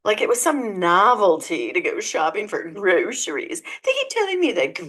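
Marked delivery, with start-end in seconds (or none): none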